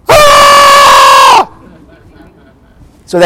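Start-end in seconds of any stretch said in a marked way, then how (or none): 0.1-1.5 sudden panicked shout of fear; a frightened cry at the top of a zip line tower; terrified yelp. Retold or imitated. A man forty feet up, too scared to jump, yelps in fear. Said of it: "and he goes".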